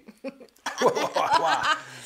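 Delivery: high, loud burst of laughter; excited laugh. Real, not acted. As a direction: laugh out loud, giddy and overexcited. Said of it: "He's just so excited".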